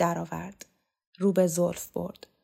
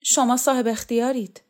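higher, louder tearful voice; understated crying, pitch raised; tearful submission; 5 semitones higher, 5 dB louder than usual. A narrator's reading. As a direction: give in through tears. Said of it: "ترکان به گریه افتاد".

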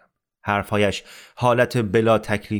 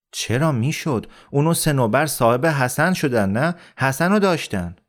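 higher-pitched, insistent denial; agitated contradiction; 5 semitones higher than usual. Acted. Amir disputes Rahim Khan's claim about Ali, agitated. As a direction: argue back with raised pitch.

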